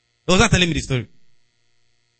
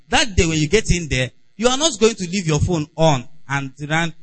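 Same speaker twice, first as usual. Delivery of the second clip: usual speaking voice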